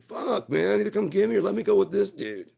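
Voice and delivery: a chained dog fed up and yelling at the house; frustrated dog yell. Acted.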